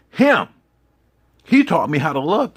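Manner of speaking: one word shouted very high and loud. Acted. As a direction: burst out with it. Shouted emphasis on father.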